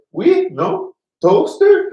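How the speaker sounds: very high, loud quizzing voice; high, punched words with a questioning lift; imitating French-speaking woman. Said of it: "She looks at me and goes".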